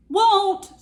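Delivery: high, loud bratty "won't"; hammed-up stubborn dragon; defiant refusal.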